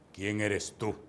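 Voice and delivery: low, grave challenge; very deep voice. Retold or imitated. Stranger confronting the young preacher at the door, very deep voice. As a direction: imitate a stranger's deep, solemn voice. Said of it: "me dice con una voz muy grave".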